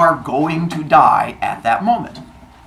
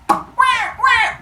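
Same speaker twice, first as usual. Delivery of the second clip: put-on high wailing baby wah; mock baby cry. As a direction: imitate a newborn's cry. Newborn baby crying.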